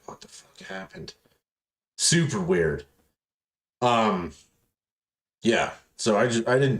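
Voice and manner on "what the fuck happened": near-whispered, breathy confusion; hushed bewilderment. Retold or imitated. Waking up days after a binge, whispered confusion.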